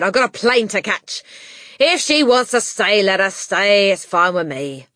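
loud, rushed dad voice; impatient father. A narrator's reading. Father hurrying to catch plane, impatient and loud. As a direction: brush them off, loud and impatient.

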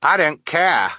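put-on high, loud child's voice; defiant child scout. Captured Snow Scout to the others, defiant. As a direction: pipe up loudly as a child.

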